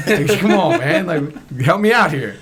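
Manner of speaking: begging the interviewer to throw him a bone; exasperated plea. Retold or imitated. Retelling his plea to the interviewer, loud and exasperated.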